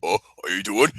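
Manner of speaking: low, big-guy introduction; John Cena impression. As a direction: greet him in a deep wrestler voice. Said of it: "impression of John Cena meeting Mister Casual".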